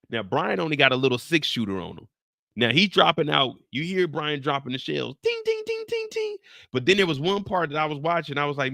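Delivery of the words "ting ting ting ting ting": very high tinny ting sounds; ping like falling casings; shell clinks. Retold or imitated. Brian dropping shells, very high tinny pings.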